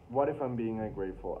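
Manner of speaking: ordinary delivery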